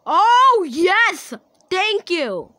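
thrilled victory whoop; excited cheer. Real, not acted. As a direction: celebrate like you just won. Winning the vote.

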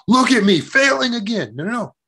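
high-pitched mock outburst at yourself; yelling self-criticism. Shown as a demonstration. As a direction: berate yourself in exaggerated frustration. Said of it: "Instead of yelling".

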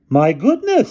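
high, loud headmistress falsetto; fussy headmistress. A narrator's reading. Headmistress at the bus entrance, high astonished voice. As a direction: exclaim in a very high ladylike voice.

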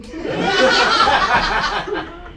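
high breathy laugh; amused laughing; laughter. Real, not acted. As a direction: burst out laughing. Laugh after poem line.

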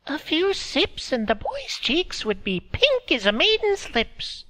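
put-on high, thin old woman's voice; old woman speaking through a laugh; old Meredy. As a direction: voice her as a frail old village woman. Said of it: "Meredy muttered on".

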